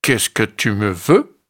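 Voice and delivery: grumpy ibex, lightly voiced; annoyed. A narrator's reading. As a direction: brush him off, annoyed. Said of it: "agacé".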